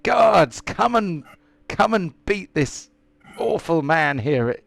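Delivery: high, loud calls for the guards; father calls the guards down on the stranger; shouting for guards. Acted. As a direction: yell Guards like an outraged father. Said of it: "he shouts out".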